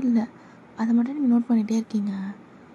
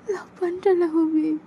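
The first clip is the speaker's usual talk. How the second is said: high and slow, crying the words; sobbing as she begs Homi; crying girlfriend; tearful plea; 7 semitones higher, much slower than usual. Acted.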